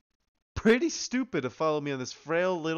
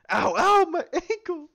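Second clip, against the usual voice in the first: high, loud yelp of pain; tripping and falling; 6 dB louder, slower than usual. Acted. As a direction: cry out after twisting ankle. Game scene, Fone trips and falls.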